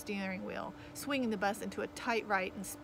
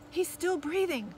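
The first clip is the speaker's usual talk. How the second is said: higher-pitched call to the driver; 8 semitones higher, 4 dB louder than usual. A narrator's reading. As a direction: call the news up the aisle. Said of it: "I called to Cap".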